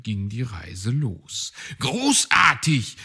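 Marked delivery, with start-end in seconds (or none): 1.8-3.0 loud, slow cheer; calling out. A narrator's reading. Fox cheering the goblin's bobsled run, loud and delighted. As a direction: cheer him on from the sidelines. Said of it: "rief Herr Fuchs".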